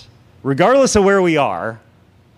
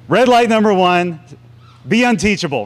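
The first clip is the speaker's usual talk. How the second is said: announcing loudly; 7 semitones higher, 5 dB louder than usual. Acted. Announcing points to congregation.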